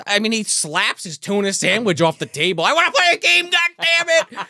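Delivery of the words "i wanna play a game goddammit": high, loud bratty outburst; child's tantrum. Acted. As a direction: throw a mock tantrum as a demanding kid. Son's tantrum, slaps sandwich away.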